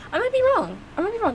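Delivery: high little caveat, said twice; high-pitched hedge. Real, not acted. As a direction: pitch it way up to hedge your guess. Hedging her own prediction.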